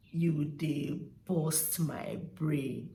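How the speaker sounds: "you dey burst my brain" heavy and slow; slow exasperated demonstration. Acted. Acting out someone fed up, slow and drawn out. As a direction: drawl it out word by word.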